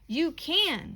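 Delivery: mother coaxing her son, lightly voiced insistence; insistent mother. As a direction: push back on the child, encouraging. Mother insists child can carry.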